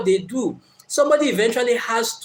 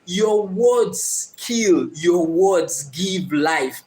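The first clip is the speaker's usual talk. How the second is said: very slow, each word weighed; slow solemn quoting of King Solomon; weighty proverb; much slower than usual. Retold or imitated. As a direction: intone the wise king's saying slowly. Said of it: "said something very powerful".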